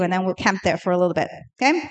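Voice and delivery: usual speaking voice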